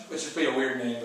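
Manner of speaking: ordinary talk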